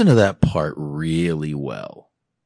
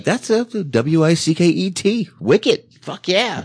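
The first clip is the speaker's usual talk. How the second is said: high-pitched, excited Boston spelling-out; imitating a kid's proud Boston "wicked", pitched way up; Boston accent; 12 semitones higher, 4 dB louder than usual. Host as his younger self misspelling wicked, high Boston accent. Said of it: "I thought wicked had a T at the end".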